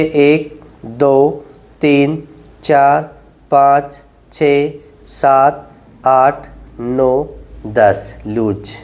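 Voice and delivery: low, drawn-out counting through the hold; slow held count. Shown as a demonstration. Physio to patients, counting out a slow ten-second hold. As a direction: count each second slowly while holding the stretch.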